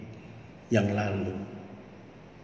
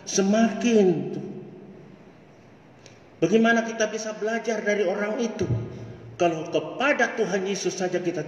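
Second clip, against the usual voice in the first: raised, ringing pulpit voice; fervent preaching; 12 semitones higher than usual. Acted. Preacher urging his brothers and sisters, fervent preaching. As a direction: preach it out to the congregation with rising fire.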